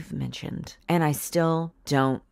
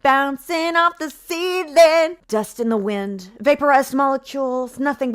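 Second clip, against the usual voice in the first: mock rapture; 9 semitones higher, 7 dB louder than usual. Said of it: "Beautiful poetry, I swear".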